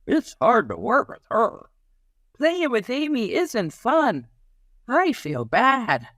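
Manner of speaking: put-on a kid whining that a classmate upsets them; child's voice. Child complaining about Amy.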